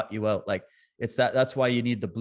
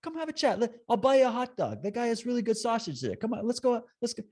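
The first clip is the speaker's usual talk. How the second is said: much higher, bright, coaxing; cheerful invite; 9 semitones higher than usual. Retold or imitated. Inviting protesters for a hot dog, much higher and bright. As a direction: lure them over with a hot dog. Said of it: "I'm still like".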